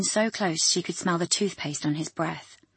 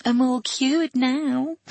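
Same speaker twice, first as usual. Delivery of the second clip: slow, high lisping mock madness; 8 semitones higher, 5 dB louder, much slower than usual. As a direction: lisp it slowly and loopy. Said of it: "she lisped".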